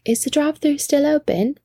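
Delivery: high-pitched mock British accent; pretend-British question, pitched well up; put-on British accent. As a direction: ask it in an amateur British accent. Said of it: "we continued the accents at Taco Bell".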